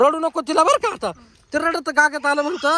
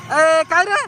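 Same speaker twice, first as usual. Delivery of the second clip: calling out; 6 dB louder, slower than usual. Real, not acted. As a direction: snap out the call to get his attention. Calling out to a boy.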